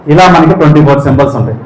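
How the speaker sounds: usual speaking voice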